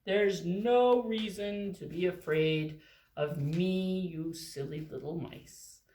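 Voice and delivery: lightly deepened friendly giant; gentle elephant. Acted. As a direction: reassure the tiny mice. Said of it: "And the elephant said".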